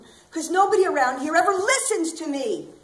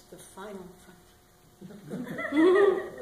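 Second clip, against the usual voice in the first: announcer parody; much slower than usual. Acted. Quoting Star Trek intro.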